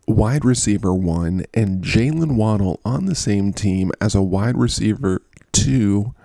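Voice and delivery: ordinary talk, nothing marked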